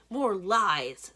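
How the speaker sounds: loud furious snarl. A narrator's reading. Fairy boss snarls at Blue, loud snarl. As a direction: spit it out with contempt. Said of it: "Sis snarls".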